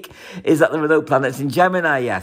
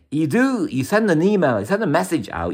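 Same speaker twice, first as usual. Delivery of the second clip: normal talking voice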